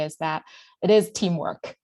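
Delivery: ordinary delivery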